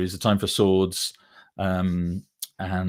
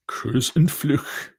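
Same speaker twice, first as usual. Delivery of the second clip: higher, slow amateur German accent; terrible German accent; 6 semitones higher, much slower than usual. Shown as a demonstration. Author showing off his translated books, terrible German accent. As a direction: sound out the German title slowly in a rough accent. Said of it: "Sorry for my terrible German accent".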